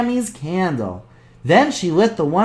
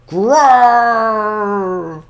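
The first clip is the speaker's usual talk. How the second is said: bear growl; 2 semitones higher than usual. Acted.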